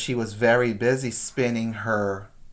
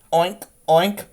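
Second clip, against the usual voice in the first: lightly voiced pig oinks, a bit higher; grunting pig; 4 semitones higher than usual. A narrator's reading. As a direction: oink playfully like a pig. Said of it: "grunted the pig".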